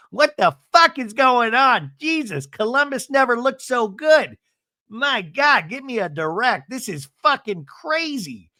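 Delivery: high, exclaiming disbelief; imagined inner monologue. Retold or imitated. Seth inwardly amazed by the fight, high and incredulous. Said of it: "you just imagine his monologue".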